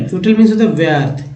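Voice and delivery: usual speaking voice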